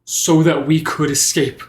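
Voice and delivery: rally the grieving friend; impassioned resolve. Acted.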